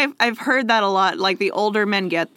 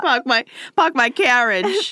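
put-on higher hammy Boston accent, no r; 5 semitones higher than usual. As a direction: drop every r, Boston style. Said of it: "We're trying to emulate it".

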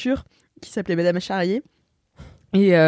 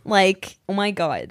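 normal talking voice

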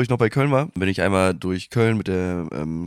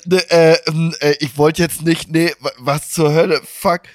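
higher, louder stammering panic; flustered panic; 6 semitones higher, 6 dB louder than usual. Retold or imitated. Himself answering the girl, flustered higher stammer. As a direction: stumble over words in shock. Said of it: "ich so".

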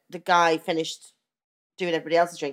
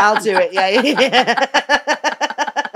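giggling yes through laughter; laughing; 5 semitones higher, 8 dB louder, much slower than usual. Real, not acted. Laughing at sponsorship joke.

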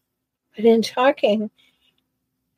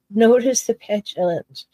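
ordinary talk, nothing marked

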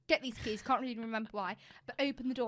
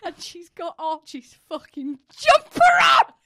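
high, loud laughing outcry; shocked shout; 7 semitones higher, 18 dB louder than usual. Real, not acted. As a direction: shriek it in disbelief. Shock at friend wearing boyfriend's jumper.

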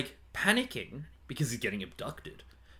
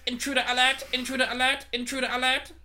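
high, loud alien siren chant; alarm shout; 13 semitones higher, 6 dB louder than usual. Retold or imitated. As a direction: repeat it like a blaring alarm. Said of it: "Just on repeat".

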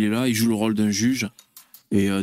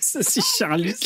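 laugh track; 11 semitones higher, 3 dB quieter than usual. Real, not acted. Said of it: "les rires enregistrés".

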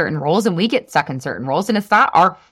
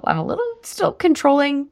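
controlling Carol; 6 semitones higher than usual. Acted. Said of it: "Controlling Carol".

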